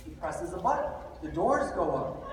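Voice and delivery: normal talking voice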